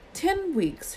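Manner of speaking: sharp high retort; snapping. A narrator's reading. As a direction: snap it back, irritated. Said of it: "Hermione snapped".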